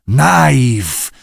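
drawn-out creature call; sea creature voice. Acted. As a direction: stretch the word like a strange sea creature. Stwory z morza wołają. (głos morskiego stwora)

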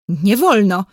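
mildly stubborn objection; a touch of defiance; insistent. A narrator's reading. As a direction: stand your ground, without shouting. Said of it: "znów upierał się Maciuś".

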